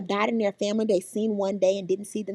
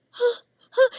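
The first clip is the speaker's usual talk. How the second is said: imitated toddler whimper; 12 semitones higher than usual. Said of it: "he's like".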